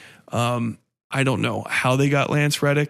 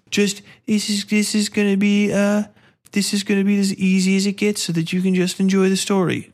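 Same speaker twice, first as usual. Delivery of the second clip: high, sing-on-one-note mock promise; mocking quote; 7 semitones higher than usual. Mocking a game's promise of easy story mode, high and patronizing. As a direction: put on a higher, patronizing voice.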